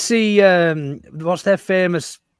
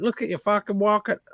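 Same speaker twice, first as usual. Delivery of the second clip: put-on higher, slow stab at a Plymouth accent; badly faked Plymouth accent; botched Plymouth accent; 5 semitones higher than usual. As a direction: imitate a heckler in a dodgy Plymouth accent. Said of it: "what the sort of accent that was".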